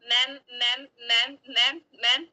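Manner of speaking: repeated halting stammer on each word; imitating her stammer. Schoolgirl stammering at the teacher in the office, imitated. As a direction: stutter it out, stuck on the first word. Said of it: "मैं ऐसे कह के ही रह गई थी".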